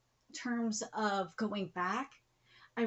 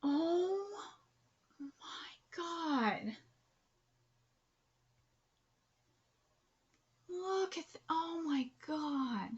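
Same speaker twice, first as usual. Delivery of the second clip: tearful whisper; 5 semitones higher, 3 dB quieter, much slower than usual. Real, not acted. Moved to tears by page.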